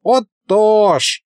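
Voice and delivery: put-on high, drawn-out boast of an old sorcerer; smug sorcerer drawing out his words; proud drawl. A narrator's reading. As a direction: stretch it out proudly. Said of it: "горделиво протянул колдун".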